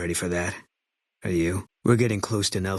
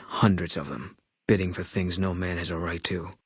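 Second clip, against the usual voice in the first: ordinary delivery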